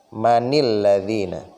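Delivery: manil ladzina read slowly, linked with kasroh; slow Arabic demo. Shown as a demonstration. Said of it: "dia menjadi apa? Kasroh".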